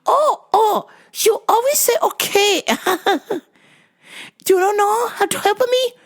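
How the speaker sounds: high put-on voice, scolding. Mom scolding the clerk for always saying okay, high mimicked voice. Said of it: "She goes".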